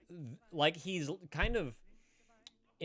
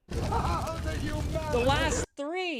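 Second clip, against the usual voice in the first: high, very slow, drawn-out amazement; stretched in awe; 7 dB louder, much slower than usual. Real, not acted. Streamer marvels at the last three gift subs, drawn out. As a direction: stretch every word in disbelief.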